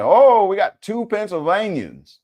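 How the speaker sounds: high, loud, drawn-out mock Pennsylvania accent. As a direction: ham up a Pennsylvania accent, stretching the vowels. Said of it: "Is that the accent?".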